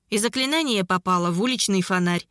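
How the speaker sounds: usual speaking voice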